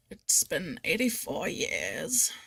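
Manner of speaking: very slow, drawn-out weary quote; mock-aged, halting delivery; old-timer reminiscing. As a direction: stretch every word like an ancient storyteller. Mimics old movie line, drawn out.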